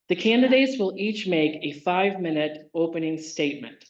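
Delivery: ordinary delivery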